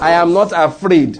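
loud, convinced declaration; like you mean it. Shown as a demonstration. Preacher has the congregation repeat after him, loud and convinced. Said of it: "do it like you mean it".

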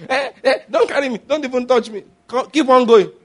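high, laughing protest; mock protesting wife. Pastor plays the wife refusing to be carried, laughing high protest.

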